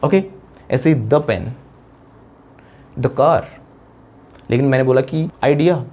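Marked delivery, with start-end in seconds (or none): 1.1-3.4 "the" as short "duh" before "pen"; "the" with a schwa, not ee, before "pen"; "the" before consonant. Shown as a demonstration. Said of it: "consonant sound होगा तो हम बोलेंगे दा".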